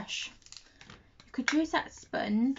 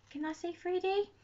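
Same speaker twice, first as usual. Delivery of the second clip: higher, softer, unsure; asking herself, quietly doubtful; high, doubtful question; 8 semitones higher, 5 dB quieter than usual. Real, not acted. Checking how to say it.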